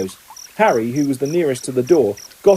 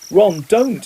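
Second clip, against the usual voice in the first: higher, sharp rebuke to Ron; angry Hermione; 6 semitones higher, 3 dB louder than usual. A narrator's reading. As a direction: stop Ron, lightly cross. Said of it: "said Hermione angrily".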